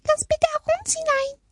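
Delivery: squeaky-high, pleading mouse voice; whining mice. A narrator's reading. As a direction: wail tiny and high like two mice. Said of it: "jammerte ein Mäusepaar". (jammernde, piepsige Mäusestimme)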